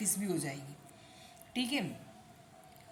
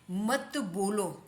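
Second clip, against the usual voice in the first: drawn-out reading for learners; slow model; much slower than usual. Shown as a demonstration. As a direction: stretch each word slowly. Teacher slowly reads out example phrase. (धीरे-धीरे खींचकर पढ़ते हुए)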